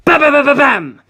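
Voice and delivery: loud rapid gunfire burst. Acted. Narrator voicing gunfire at Doppio's face. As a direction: rattle off the shots.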